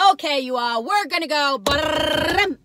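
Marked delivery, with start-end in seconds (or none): 1.6-2.6 engine rev noise. Pretend. Revving car noise.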